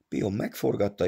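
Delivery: plain reading voice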